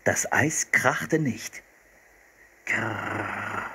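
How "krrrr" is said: soft rolled growl like a polar bear; quiet mock bear rumble. A narrator's reading. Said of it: "brummte er".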